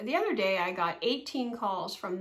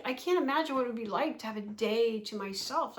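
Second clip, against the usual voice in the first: ordinary delivery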